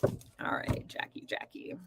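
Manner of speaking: whispered to herself while shuffling. Real, not acted. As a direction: breathe the name under your breath.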